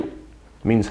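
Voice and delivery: ordinary delivery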